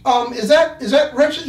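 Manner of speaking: hammed-up worried cop; nervous cop. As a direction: cop asking about the gun. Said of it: "cop asking".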